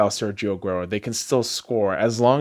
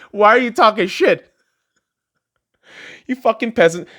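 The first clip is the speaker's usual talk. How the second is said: taunting rant; 10 semitones higher, 5 dB louder than usual. Real, not acted. Taunting rival fans.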